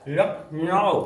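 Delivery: लखनऊ drawn out as "lak... naao"; English-style pronunciation. Shown as a demonstration. Teacher shows students how it comes out automatically, लखनऊ slowly as "lak-naao". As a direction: stretch both halves of लखनऊ, long gliding "naao". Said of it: "इसको बोलोगे, देखो आप".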